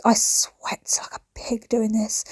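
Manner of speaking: slow unvoiced whisper; whispered confession. Real, not acted. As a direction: confide it under your breath. Confiding about ironing, whispered.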